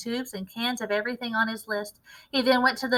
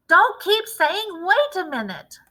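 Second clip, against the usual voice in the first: slow, much higher and louder, fed up; exasperated shout; 9 semitones higher, 7 dB louder, slower than usual. A narrator's reading. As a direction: bark it back at the boy. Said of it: "shouted Mister Cranky".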